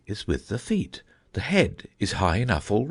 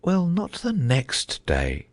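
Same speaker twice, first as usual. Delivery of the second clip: slow and musing, higher; slow, thoughtful; 6 semitones higher, slower than usual. A narrator's reading. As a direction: drag it out, lost in thought. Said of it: "the knight repeated as before".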